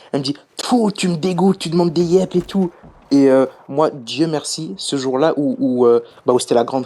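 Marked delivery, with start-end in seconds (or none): 0.6-2.7 high-pitched mock outrage; imitated angry girl. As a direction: imitate her furious, disgusted voice message. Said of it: "en mode bien vénère".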